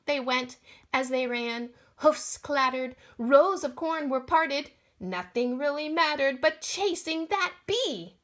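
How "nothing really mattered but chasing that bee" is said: ordinary delivery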